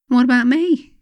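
a sudden indignant cry of what about me; indignant protest. Retold or imitated.

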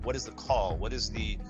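ordinary delivery

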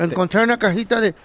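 normal talking voice

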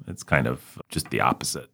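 ordinary talk

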